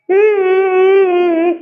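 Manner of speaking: loud, high-pitched acted sobs; crying. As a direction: wail in hummed, drawn-out sobs. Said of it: "அழுதுட்டு இருக்க".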